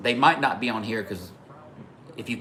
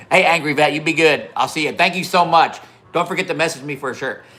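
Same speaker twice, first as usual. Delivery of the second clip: loud, higher cheery send-off; calling out farewell; 5 semitones higher, 6 dB louder than usual. Real, not acted. Streamer thanking a departing viewer, loud laughing send-off. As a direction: call thanks out through leftover laughter.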